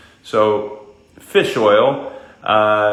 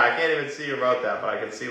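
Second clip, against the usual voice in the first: ordinary talk, nothing marked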